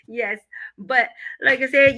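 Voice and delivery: normal talking voice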